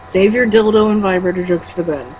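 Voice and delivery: ordinary talk, nothing marked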